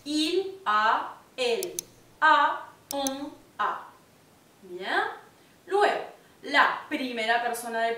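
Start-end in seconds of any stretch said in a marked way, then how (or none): 0.0-3.9 "Il a, elle a, on a" slow, each a plain; French il a, elle a, on a. Shown as a demonstration. Teacher drilling the third person: slow, clear. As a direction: spell out "a" as a lone vowel, slowly. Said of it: "se van a conjugar como a".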